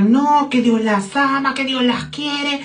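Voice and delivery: drawn-out, slow imitation of a pushy preacher; mock preacher yell. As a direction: mimic his pleading cry, stretching the words. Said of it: "El hombre nos empezó a gritar". (imitación alargada de un predicador gritón)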